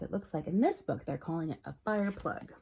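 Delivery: ordinary delivery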